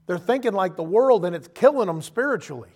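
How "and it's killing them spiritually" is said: ordinary talk, nothing marked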